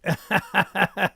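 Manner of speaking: loud, high burst of laughs; laughter. Real, not acted. Streamer reading the live chat, bursts out laughing. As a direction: break into a real, rapid laugh.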